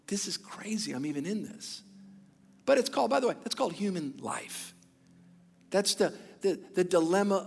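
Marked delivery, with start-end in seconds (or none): 0.1-1.8 whispered aside. Retold or imitated. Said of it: "I'm like".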